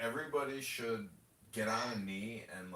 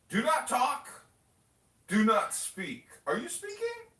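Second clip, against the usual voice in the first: loud, high barked courtroom orders; hammed-up judicial scolding; mock stern judge; 13 semitones higher, 10 dB louder than usual. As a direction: silence them like an imperious judge. Acting out a dismissive judge.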